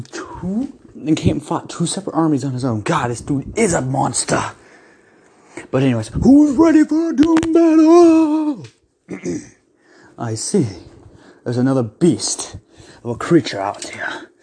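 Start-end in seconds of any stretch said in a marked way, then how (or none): 6.1-8.7 big hyped arena announcer call; booming fighting game announcer. Acted. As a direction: boom it out like a fight host. Hypes up the coming fight.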